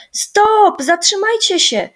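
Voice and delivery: high, loud cry to stop; boy yelling high and loud from the back; calling out. A narrator's reading. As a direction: shout to the others to halt. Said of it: "zawołał Rysiek".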